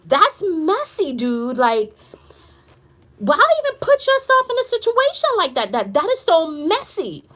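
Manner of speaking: incredulous, rising high on messy; exasperated. Retold or imitated. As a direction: scold him in disbelief. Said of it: "I said".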